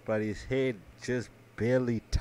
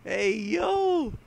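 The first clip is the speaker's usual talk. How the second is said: much higher, thrilled yell-like exclamation; excited hype. Retold or imitated. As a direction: hype up like you just saw a sick move. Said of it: "and I was like".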